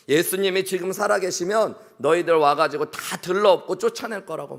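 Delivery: retold drunken shouting. Drunk market merchant rails at the church over selling, retold angry shouting. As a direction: imitate an irate man yelling accusations. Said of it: "소리를 지르고 있는 겁니다".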